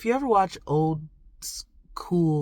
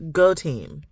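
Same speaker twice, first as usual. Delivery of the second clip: "go team" said out firmly and louder; a firm little rally cry; louder cheer; 5 dB louder than usual. Real, not acted. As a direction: cheer it out loud.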